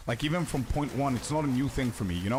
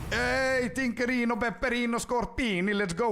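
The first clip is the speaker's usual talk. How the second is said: much higher, excited sing-song call; hyped Scorpius way; 10 semitones higher than usual. Shown as a demonstration. Streamer demoing the hype reaction for chat, excited. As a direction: cheer the loot drop like a hype announcer. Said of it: "I'm gonna do the Scorpius way".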